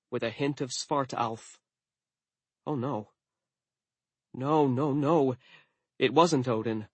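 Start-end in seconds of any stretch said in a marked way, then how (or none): none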